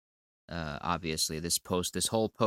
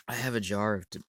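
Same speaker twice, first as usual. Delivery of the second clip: ordinary delivery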